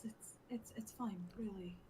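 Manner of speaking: hushed, hesitant comfort; soft reassurance. Acted. Reassuring the companion who saw the attack, softly. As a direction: play it down in a near whisper.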